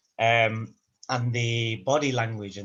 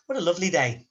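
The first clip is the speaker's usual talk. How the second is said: sarcastic What a lovely day, pitched up; 6 semitones higher, faster than usual. Shown as a demonstration. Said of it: "It's sarcasm".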